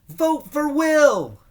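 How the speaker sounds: high, loud, drawn-out campaign cry; rally the crowd with a long shout; yelled. A narrator's reading. Brother cheering at the crowd, loud drawn-out yell. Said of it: "Steve yelled".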